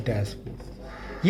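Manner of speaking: a slow whispered डैशपोर for pupils to remember. Shown as a demonstration. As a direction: breathe डैशपोर out slowly in a whisper. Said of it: "आप साथ साथ बोलेंगे".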